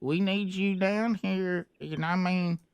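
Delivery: put-on slurred, stumbling drunk; lightly voiced drunk Gary; drunk guy impression. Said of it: "your drunk guy impression".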